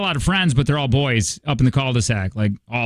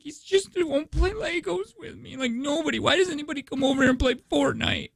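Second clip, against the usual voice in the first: put-on high, softer, whiny sobbing child voice; imitating crying daughter; 12 semitones higher, 7 dB quieter than usual. Dad imitating his upset daughter crying over Fortnite, high and whiny. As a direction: mimic a little girl wailing through tears. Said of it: "just starts crying".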